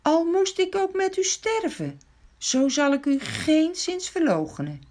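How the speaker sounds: Peter's pledge, pitched high and earnest; fervent Peter vow. A narrator's reading. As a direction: swear loyalty with all your heart. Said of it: "Hij zei zelfs".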